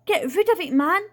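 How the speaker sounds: high, loud, urgent order; frightened aunt shouting a command; alarmed yell. A narrator's reading. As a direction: yell it in a panic. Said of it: "she yelled".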